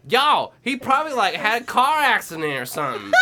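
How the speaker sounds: high, loud mimicry of a friend; Bailey. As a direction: imitate a friend blurting it out. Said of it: "Bailey was like".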